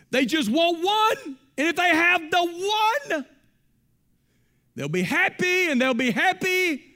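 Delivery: high, loud preacher's shout, slow and driving; full-force preaching. Acted. Pastor warning the church about the prison of want, shouted preaching. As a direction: preach it hard to the congregation, hammering every line. Preaching resumes after joke.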